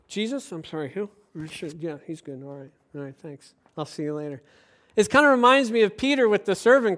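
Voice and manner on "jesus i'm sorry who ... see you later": mock embarrassed mumble, much softer; sheepish brush-off. Believer out in the crowd dodging talk of Jesus, sheepish mumble. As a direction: duck the question and back away quietly. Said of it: "Then we get out into the crowd".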